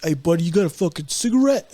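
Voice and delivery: voiced aloud, mock street-guy ask; casual rough request. Biter approaching a man for a cigarette, spoken aloud. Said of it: "So he's like".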